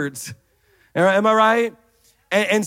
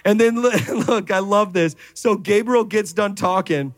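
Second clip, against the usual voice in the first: normal talking voice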